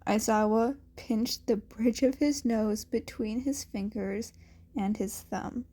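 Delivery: ordinary delivery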